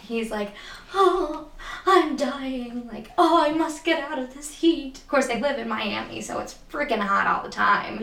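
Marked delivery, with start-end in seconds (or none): 0.9-5.0 mock wilting from the heat, high and theatrical; melodramatic Albie. Friend retelling Albie's fake illness, hammed-up high diva. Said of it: "he's just so melodramatic".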